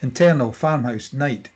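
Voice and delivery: ordinary talk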